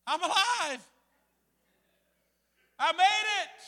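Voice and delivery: high, loud re-enacted relief; triumphant cry. Rafter flushed out alive, high triumphant cry. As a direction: exclaim it like a survivor, hammed up. Surviving the rapid, retold elation.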